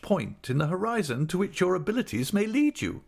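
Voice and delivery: high, insistent lecturing wife; Mrs Micawber voice. A narrator's reading. Wife lecturing husband on ambition, high and insistent. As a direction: press the point like a grand matron.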